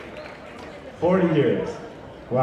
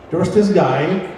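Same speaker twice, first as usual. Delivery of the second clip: ordinary talk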